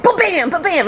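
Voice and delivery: high, punchy spoken sound effect; imitated car bumps. Police car going over the sidewalk, punchy imitation. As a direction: bounce the syllables like a car jumping a kerb.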